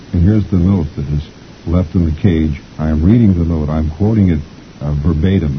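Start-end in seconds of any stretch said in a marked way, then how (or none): none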